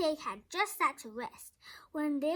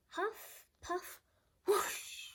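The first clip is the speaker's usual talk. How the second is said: blowing sounds; 5 semitones higher, 5 dB quieter, much slower than usual. A narrator's reading. Wolf blowing house down.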